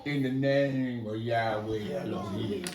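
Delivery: low, soft, unhurried invocation; solemn invocation. Acted. Asher walks up to call on Yahweh, low solemn invocation. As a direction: drop low and quiet to invoke. Prophet calmly invokes his God.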